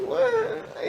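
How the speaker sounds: high laughing what; surprised what. Retold or imitated. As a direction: squeak out a startled what. Own surprised reaction retold.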